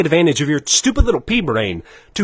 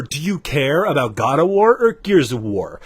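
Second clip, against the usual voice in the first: ordinary delivery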